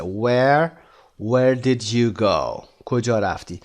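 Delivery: usual speaking voice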